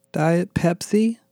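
high, drawn-out whine; whined. A narrator's reading. Becky asking Daniel for another drink, whining. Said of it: "she whined".

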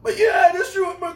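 hammed-up wailing sob; mock crying. Movie character crying after shooting girl.

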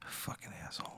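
whispered curse under the breath. Real, not acted. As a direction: hiss it under your breath. Player curses wizard under breath.

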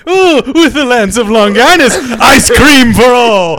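beam out a generous promise; mock benevolent dictator. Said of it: "Was he going".

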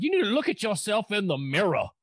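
mocking a Tennessee twang at her; Southern accent. Imitating a Southern actress's line: Southern accent. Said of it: "sounded like she was from Tennessee".

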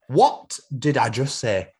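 slow, deliberate Yorkshire challenge; Yorkshire boss. Acted. Boss making Jeff answer, slow stern Yorkshire accent. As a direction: spell out each word in Yorkshire. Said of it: "has been built in Yorkshire".

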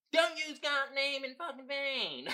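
high, slow grandmother imitation; scolding grandmother. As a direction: put on grandma's drawn-out scolding. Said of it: "My grandmother".

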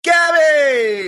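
loud, high shout of a name; calling out. Real, not acted. Host calls to the barking dog, loud high shout. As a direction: holler the name, high and drawn out.

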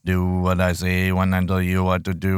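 low put-on villain voice, repeated; low menacing voice.

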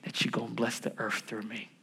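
low, soft murmured prayer; hushed acted prayer. Abraham gives God glory for the promise, hushed. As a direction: pray it privately, well under your preaching voice.

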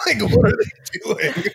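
high, amused disbelief; asking in mock shock; incredulous. Real, not acted. Laughing disbelief at robot.

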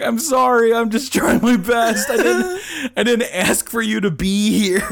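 mock apology. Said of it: "And I'm like".

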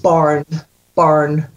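"barn" with an open "a"; Scots "barn". Shown as a demonstration. Coach demonstrating Scots "born" as "barn". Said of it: "rewrite how you've written the word".

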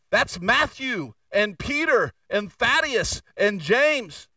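ordinary talk, nothing marked

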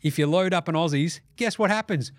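ordinary talk

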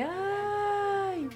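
high thin cry, as if heard from far off; distant wail. Retold or imitated. Girl alone at night mimicking a distant ghostly cry. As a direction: imitate a faraway wail. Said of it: "escucho un grito como de lejos".

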